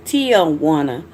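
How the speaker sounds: Tijuana said as "TEE-o-wanna"; careful name pronunciation. Shown as a demonstration. Speaker explaining her different name to viewers, careful pronunciation. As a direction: sound Tijuana with a clear "tee-o" opening. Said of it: "which is different".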